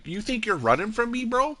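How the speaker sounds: high-pitched challenge, not shouted; mock confrontation. Acted chase in fight mode.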